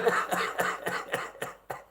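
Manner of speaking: breathy, voiceless laughter; wheezy laugh. Real, not acted. Amused by the tone complaint.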